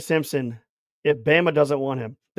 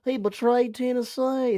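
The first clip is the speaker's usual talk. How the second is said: higher, sneering imitation of an upset fan; mocking a whiny fan; 6 semitones higher than usual. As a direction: mimic the complaining fan, higher pitched. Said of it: "And you're like".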